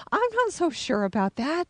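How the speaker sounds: high, disbelieving reply; a touch of high incredulity; doubtful. Retold or imitated. She doubts the girl's claim, in a high disbelieving voice.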